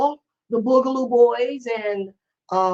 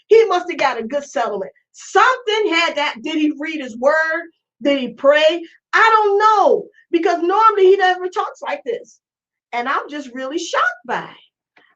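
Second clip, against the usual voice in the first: normal talking voice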